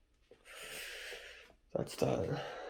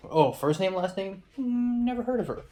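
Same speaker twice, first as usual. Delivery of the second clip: lightly voiced clueless guy, pitched higher; mock clueless guy; 6 semitones higher than usual. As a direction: put on a higher voice for a dismissive guy. Acting out the schmuck guy's reply.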